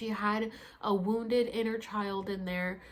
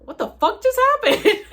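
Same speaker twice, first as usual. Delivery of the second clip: high, loud laughing exclamation; retold cracking up; 12 semitones higher, 14 dB louder than usual. Telling how she fell flat, cracking up loudly. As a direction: burst out laughing through the words. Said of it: "I start cracking up".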